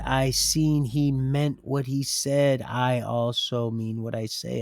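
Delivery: ordinary talk, nothing marked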